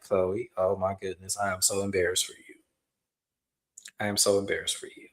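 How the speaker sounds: low, soft, quick aside; embarrassed for her. Retold or imitated. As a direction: drop down quietly, mortified on her behalf. Host's mortified aside to Chloe.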